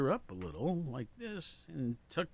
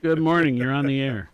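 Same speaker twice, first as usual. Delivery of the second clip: loud, raised radio host hello; booming on-air greeting; 3 semitones higher, 12 dB louder than usual. Real, not acted. Radio host takes a call, booming greeting. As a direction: project a big welcome to the caller.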